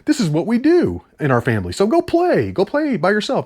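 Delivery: high, put-on dismissive dad voice; mocking the dad. Reviewer mocking the father brushing off his son's worry, high and dismissive. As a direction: wave the kid off in a silly voice. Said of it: "He's like".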